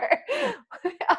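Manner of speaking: bright high burst of laughter; real amused laugh. Real, not acted. Laughs at own joke.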